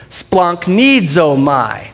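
"splanchnizomai" drawn out slowly, syllable by syllable; demonstrated pronunciation. Shown as a demonstration. Preacher showing how to pronounce "splanchnizomai", slow and drawn out. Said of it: "You wanna say it with me?".

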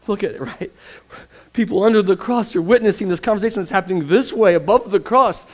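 normal talking voice

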